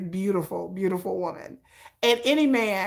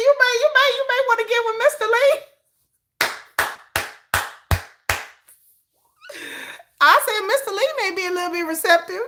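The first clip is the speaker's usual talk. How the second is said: high, loud, crying with laughter; laughing hard while talking; 12 semitones higher, 3 dB louder, faster than usual. Real, not acted. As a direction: talk through tears of laughter. Cracking up suggesting Mister Lee.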